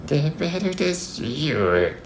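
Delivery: wolf's hushed, high pretend old lady; wolf posing as Grandma. A narrator's reading.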